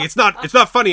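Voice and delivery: loud, high, imitated telling-off; serious rebuke. A guy scolding three laughing women in the theater, serious. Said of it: "like, he sounded serious".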